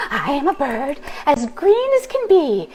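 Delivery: bird voice. Acted. Green bird asking the witch for a ride, high and chirpy. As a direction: squeak it out like an eager little bird. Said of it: "then said".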